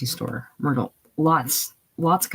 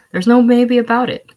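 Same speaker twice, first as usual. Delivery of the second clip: forceful retort; 8 semitones higher, 8 dB louder than usual. Acted. Heated denial in argument.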